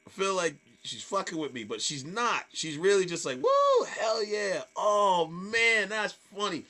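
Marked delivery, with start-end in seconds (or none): 3.4-6.6 high, drawn-out imitated whoop; over-encouraging heckler. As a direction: gush mock approval like a tipsy fan. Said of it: "very encouraging, but over encouragingly".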